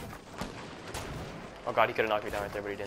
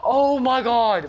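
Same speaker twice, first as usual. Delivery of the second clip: shocked yell; 9 semitones higher, 7 dB louder, much slower than usual. Real, not acted. Stunned reaction in game.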